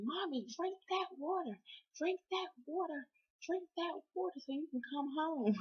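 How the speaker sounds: put-on high-pitched child voice cheering mom on; imitating kids' message. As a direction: chant it high like kids urging Mommy. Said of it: "my kids, my husband left me a message".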